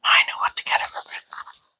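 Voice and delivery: soft, whispered note to self; whispered aside. Real, not acted. Co-host noting a Christmas gift idea, whispered aside.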